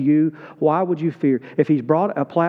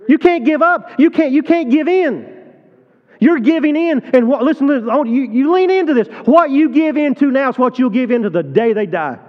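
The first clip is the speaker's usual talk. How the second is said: high, loud, urgent preaching; preaching at full force; 8 semitones higher, 4 dB louder than usual. Real, not acted. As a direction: press the congregation hard, pleading. Preacher pleads with parents.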